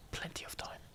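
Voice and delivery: very soft, voiceless whisper; whispered echo. Real, not acted. Player reassuring self about the clock, whispered. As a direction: whisper it under your breath.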